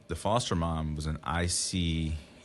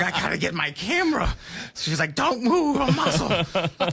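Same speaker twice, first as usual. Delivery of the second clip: excited foster mom; 12 semitones higher, 4 dB louder than usual. Retold or imitated. Dad imitating the thrilled foster mom fetching her camera.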